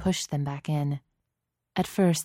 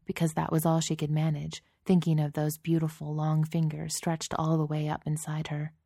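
ordinary delivery

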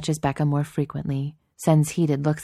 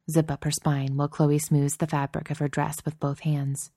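ordinary delivery, nothing marked

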